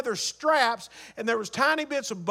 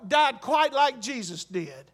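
usual speaking voice

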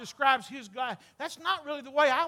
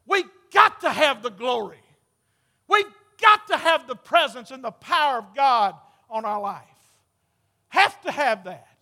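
preaching; 10 dB louder than usual. Acted. Preaching at full force.

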